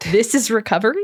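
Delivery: high-pitched mock disbelief; incredulous. Newcomer reacting to "welcome to recovery", acted incredulous.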